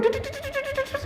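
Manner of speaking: high, squeaky tut tut like an electric shock; electric buzz tut. Shown as a demonstration. Preacher shows how a parent's bond feels, squeaky tut sounds. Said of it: "kayak setrum".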